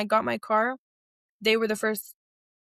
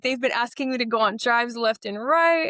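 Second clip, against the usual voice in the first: ordinary delivery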